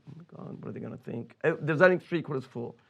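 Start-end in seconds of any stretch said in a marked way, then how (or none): 0.1-1.4 anxious thought. Retold or imitated. Inner panic at screening.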